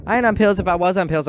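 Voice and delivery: high, loud mock indignation; indignant retort. Student answering the teacher about pills, indignant.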